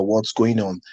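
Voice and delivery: normal talking voice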